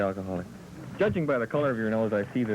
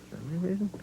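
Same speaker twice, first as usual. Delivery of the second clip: surprised; 7 semitones higher, 4 dB quieter, faster than usual. Acted. Mock double take on a phrase.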